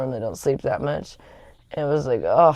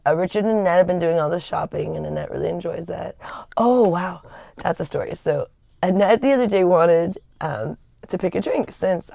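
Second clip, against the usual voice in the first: normal talking voice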